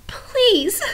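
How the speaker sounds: high, slow tearful begging; high pleading whimper; crying plea. Acted. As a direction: plead for the princess through tears. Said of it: "he was crying now".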